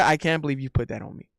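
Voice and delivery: ordinary talk, nothing marked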